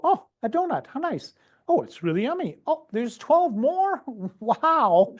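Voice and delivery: high, gushing delight over donuts; impulsive overeater. Retold or imitated. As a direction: squeal over the donuts like a kid. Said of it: "they go".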